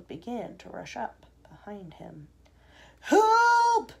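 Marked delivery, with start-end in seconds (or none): 3.0-3.9 high, loud cry for help; drawn-out panicked yell; shouting. A narrator's reading. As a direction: shout it long, in alarm. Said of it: "he shouted".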